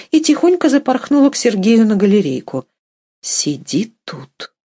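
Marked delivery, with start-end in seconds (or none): 3.2-4.5 slow, soft secretive order; hushed whisper. A narrator's reading. Wife hiding her lover on the gallery, whispering. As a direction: drop to a slow, hushed undertone. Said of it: "шепнула она".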